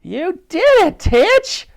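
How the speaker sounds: put-on loud, very high excited girl's yell. A narrator's reading. Elsie celebrates Titch's hit, yelling. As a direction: yell it in delight. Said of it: "yelled Elsie".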